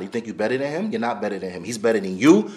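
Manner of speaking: ordinary talk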